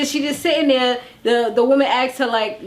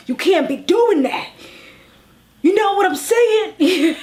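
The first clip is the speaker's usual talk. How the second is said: godmother voice; 7 semitones higher than usual. Retold or imitated. Vlogger imitates Deb scolding a castmate in godmother voice. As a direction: lay down the law like a mob godmother, lightly hammed. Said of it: "It was like the godmother voice".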